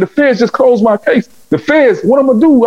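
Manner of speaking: high, frantic retold yell; yelling to his mentor about his closed case, high and frantic; panicked yelling. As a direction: cry out in alarm about the feds. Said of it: "I was yelling".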